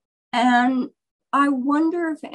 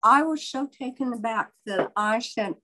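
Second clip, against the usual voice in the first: normal talking voice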